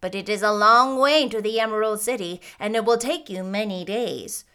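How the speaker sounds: grave. A narrator's reading. Munchkin warning the girl about the dangerous road, grave. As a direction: warn with grave weight. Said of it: "answered Boq gravely".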